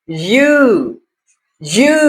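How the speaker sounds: यू stretched out on each take; long U. Shown as a demonstration. Teacher repeats the long U for learners, drawn out. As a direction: hold the oo in each यू. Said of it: "इसको लंबा करना है".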